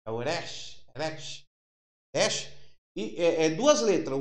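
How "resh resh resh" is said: "resh" repeated slowly, the r rolled softly at the front of the mouth; Hebrew letter resh. Shown as a demonstration. Teacher drilling his class on the Hebrew letter, patient pronunciation demo. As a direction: drill "resh" with a light trilled r, each on a rising tune. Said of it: "ele se pronuncia resh".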